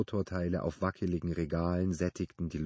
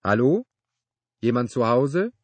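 loud, raised call from outside; brittle-voiced call; 5 semitones higher, 11 dB louder than usual. A narrator's reading. Neighbor calling from outside the workshop, brittle-voiced shout. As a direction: call out with a questioning lift. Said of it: "eine brüchige Stimme rief von draußen".

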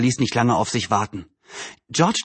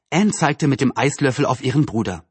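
usual speaking voice

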